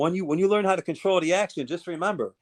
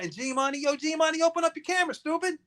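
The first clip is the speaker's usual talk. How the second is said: high taunting call; 10 semitones higher, 5 dB quieter, faster than usual. Real, not acted. Taunting someone on stream.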